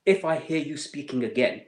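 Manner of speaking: retold scream. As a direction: imitate a soldier's screamed warning, held back. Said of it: "and he screamed".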